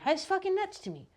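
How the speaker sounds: exasperated. Real, not acted. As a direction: throw up your hands in disbelief. Exasperated peak of rant.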